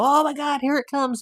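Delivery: imitating onlookers crying out in alarm; retold panicked cry. Canadians seeing the faulty plane coming, mock alarm.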